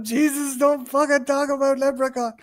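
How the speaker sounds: mimicked friend's impression. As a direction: lightly mimic someone doing a take-off of you. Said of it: "I love Joey taking me off".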